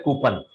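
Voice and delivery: "कूपन" as "koo-pun"; short, weak "pun" ending in "कूपन"; common mispronunciation. Shown as a demonstration. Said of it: "Normally लोग इसको बोलते हैं". ("कूपन" के अंत में छोटा "पन")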